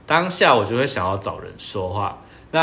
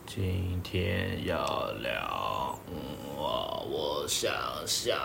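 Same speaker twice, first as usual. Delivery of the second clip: put-on slow, low, mumbling drunk act; 5 semitones lower, 10 dB quieter, much slower than usual. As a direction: drag the words like you're tipsy. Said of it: "我在演喝醉". (装醉，慢吞吞含糊地说)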